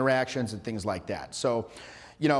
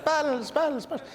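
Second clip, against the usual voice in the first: high mock Netherlands accent; squeaky, hammed-up Netherlands-accent echo; 12 semitones higher, much slower than usual. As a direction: imitate giggly girls with a Netherlands accent. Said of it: "That's like my Netherlands accent".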